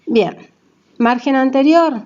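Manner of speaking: normal talking voice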